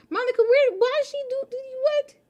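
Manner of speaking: high, baffled squeaks; confused squeal. Real, not acted. Streamer baffled by the glitching game, high confused squeal. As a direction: voice leaps up in disbelief.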